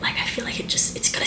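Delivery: conspiratorial whisper about the plan; sly whisper. Real, not acted. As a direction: let them in on a secret, hushed. Conspiratorial aside about agents.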